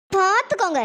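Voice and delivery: ordinary delivery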